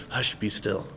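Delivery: calm the sea without raising your voice at all; quiet hush. Acted. Jesus quietly stilling the storm, soft and low. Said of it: "not in a loud, boisterous voice".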